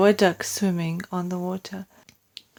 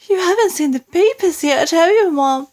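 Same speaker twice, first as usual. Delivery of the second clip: maid; 9 semitones higher, 4 dB louder than usual. A narrator's reading. Maid hinting at news.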